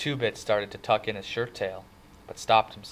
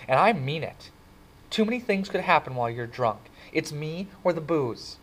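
higher, louder scolding, slowing on the last words; mad; 5 semitones higher, 5 dB louder than usual. Acted. Cherry scolding her drunk date Bob, angry and higher. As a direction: tell him off hard, ultimatum at the end. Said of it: "Cherry looked mad".

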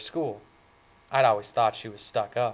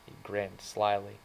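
ordinary delivery

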